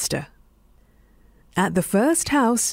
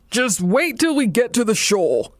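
mild growl; 3 semitones higher than usual. A narrator's reading. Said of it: "he growled".

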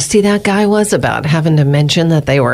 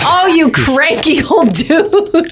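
teasing; 10 semitones higher, much slower than usual. Real, not acted. Mocking the grumpy newsreader.

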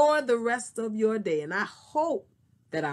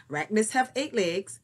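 normal talking voice

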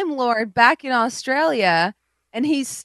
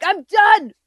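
higher, louder fed-up dad; mock angry father; 6 semitones higher, 5 dB louder, slower than usual. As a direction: storm off like a furious dad. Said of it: "cut to the father being like".

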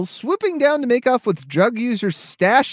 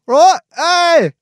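mock British accent; 9 semitones higher, 6 dB louder than usual. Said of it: "that's a brilliant accent you've got there, Governor".

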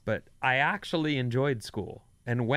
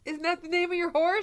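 teasing; 13 semitones higher than usual. Pretend. Teasing high voice.

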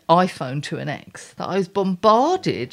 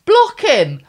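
louder, higher ranting command; block him; 7 semitones higher, 8 dB louder, slower than usual. Acted. Ranting at couples on TV, loud command. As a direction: bark the order at the screen.

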